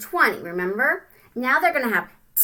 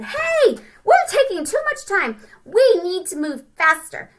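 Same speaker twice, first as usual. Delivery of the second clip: little ant voice; 8 semitones higher, 6 dB louder than usual. Retold or imitated. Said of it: "the ants again and said".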